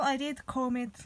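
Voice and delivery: normal talking voice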